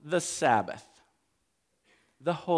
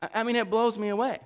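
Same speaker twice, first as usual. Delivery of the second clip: ordinary delivery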